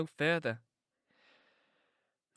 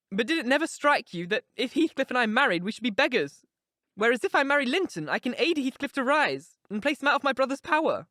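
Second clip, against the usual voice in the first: put-on higher, urgent young woman confiding; Catherine, passionate; 8 semitones higher, 5 dB louder than usual. A narrator's reading. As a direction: pour out her heart to Nelly, raised voice. Catherine voiced in reading.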